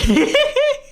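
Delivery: sudden high cackle; loud, high laugh at his own joke; high burst of laughter. Real, not acted.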